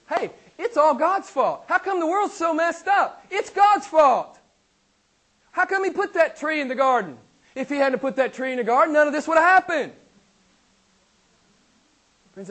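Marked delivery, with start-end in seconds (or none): 0.1-9.9 much higher, louder whining complaint; mocking unbeliever. Preacher imitating unbelievers blaming God, high and whiny. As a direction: sneer it out like a skeptic blaming God. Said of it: "this is one of the things I always hear".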